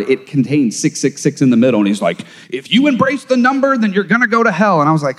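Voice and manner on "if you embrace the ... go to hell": high, hammed-up doomsayer voice; mock panic, pitched up high; mock alarmed warning. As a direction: imitate a frightened man preaching hell. Mocking a fearful man's warning.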